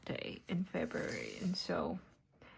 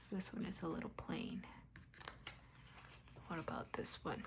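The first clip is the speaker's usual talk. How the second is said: whispering; 12 dB quieter than usual. Acted. Whispered craft chat.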